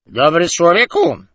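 put-on loud, slow, drunken mumble; drunken slur. A narrator's reading. Drunk man hailing the doorman, slurring loudly. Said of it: "zabełkotał".

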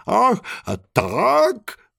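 high, drawn-out call. Acted. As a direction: call it out slowly, high in pitch. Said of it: "wołał żółw".

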